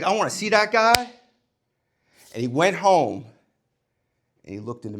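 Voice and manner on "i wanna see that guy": annoyed. Retold or imitated. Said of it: "He's like".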